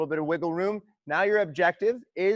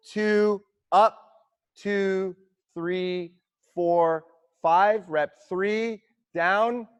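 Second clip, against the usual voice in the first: loud, rhythmic coach counting cadence; counting squat tempo aloud over music; calling out rep counts; 4 semitones higher, 5 dB louder, much slower than usual. Real, not acted. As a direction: drive the class through each count. Coach calling second set cadence.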